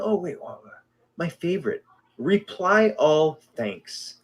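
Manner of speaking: usual speaking voice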